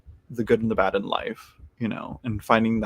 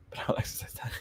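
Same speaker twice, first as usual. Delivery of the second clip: whispered aside; 12 dB quieter, much faster than usual. Real, not acted.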